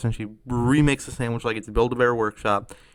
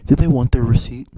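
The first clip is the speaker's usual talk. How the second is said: suddenly loud retold customer question; loud demand; 11 dB louder than usual. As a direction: blurt it out loudly as the customer. Customer snaps at the cashier.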